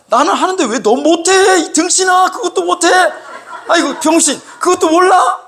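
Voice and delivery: high, loud, insulting outburst; angry scolding. Acted. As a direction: berate them at the top of your voice. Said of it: "못하는 그 사람 보고 화를 내는 거야".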